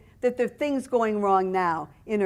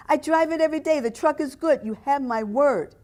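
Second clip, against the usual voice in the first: put-on higher pleading Italian-accented seller; 7 semitones higher, 5 dB louder than usual. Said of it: "He spoke with a thick Italian accent".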